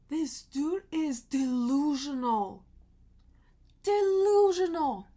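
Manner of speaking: loud, drawn-out indignant verdict; real exasperation. Real, not acted. Venting that this dude is delusional, loud and exasperated.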